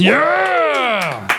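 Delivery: high cheering shout; excited shout. Acted. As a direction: whoop it up for the intro. Hyped cheer to intro.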